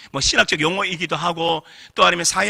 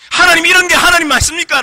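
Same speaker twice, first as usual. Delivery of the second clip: high, loud indignant protest; a father crying out against God, much higher and louder; 10 semitones higher, 9 dB louder than usual. Retold or imitated. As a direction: demand an answer from God, voice raised high. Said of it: "라고 난 따졌어요".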